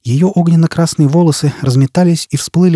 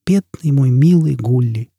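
slow, tender murmur; tender hush; 2 semitones higher, slower than usual. Acted. Jiz murmurs tenderly to Foyle in the dark. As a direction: cradle the name slowly. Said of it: "шептала Джизбелла".

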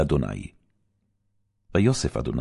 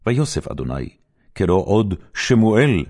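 ordinary delivery

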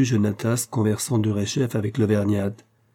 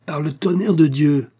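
loud, higher furious oath; angry exclamation; 6 semitones higher, 5 dB louder than usual. A narrator's reading. Raphaël curses at the hovering peasant woman, loud. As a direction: burst out in fury. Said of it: "s'écria-t-il".

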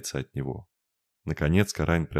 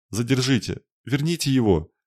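high, louder, desperate begging; desperate plea; 7 semitones higher, 3 dB louder than usual. A narrator's reading. Lorna begs the karains to bring Raine back, desperate. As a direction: plead as if his life hangs on it. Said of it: "отчаянно взмолилась Лорна".

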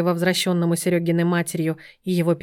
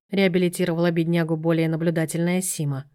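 usual speaking voice

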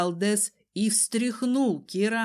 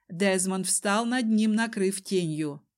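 usual speaking voice

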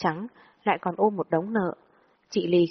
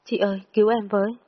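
tearful cry for help, mildly acted; crying plea; 3 semitones higher than usual. A narrator's reading. Sobbing narrator calls her friend for rescue, mild tearful plea. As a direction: plead through tears, a touch urgent. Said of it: "bật khóc lớn".

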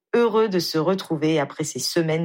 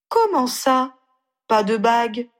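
higher, louder indignant protest; indignant outburst; 6 semitones higher, 3 dB louder than usual. Acted. Ginny objecting to Harry about no ring, indignant and higher. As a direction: bristle at the missing ring. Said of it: "s'insurgea-t-elle".

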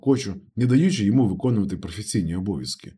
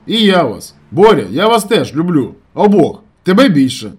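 loud, high declarations; shouted affection; 8 semitones higher, 11 dB louder than usual. A narrator's reading. Narrator telling his friends he loves them, shouting. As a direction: call out your love down the line.

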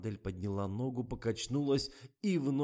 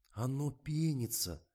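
pointed objection; 6 semitones higher, slower than usual. A narrator's reading. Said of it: "тыкал пальцем в бокал Жорик".

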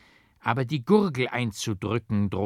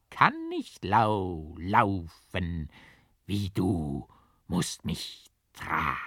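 cling and cackle like a mad ghost; giggling ghost; 3 semitones higher, much slower than usual. A narrator's reading. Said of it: "So lachte und heulte das grause Gespenst".

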